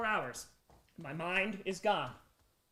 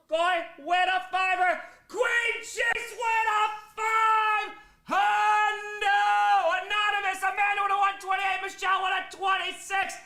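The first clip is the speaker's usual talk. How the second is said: high, loud, stretched-out donation calls; sing-shout each name like an announcer; hyped shout; 10 semitones higher, 5 dB louder, slower than usual. Real, not acted. Hype reading donations, shouting.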